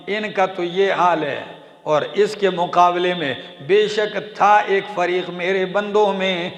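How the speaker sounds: chanted Quran verse, then ardent translation; preaching recitation. Acted. Preacher reciting to congregation, fervent chant. Recites believers' prayer and rebuke.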